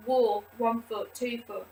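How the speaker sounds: ordinary talk, nothing marked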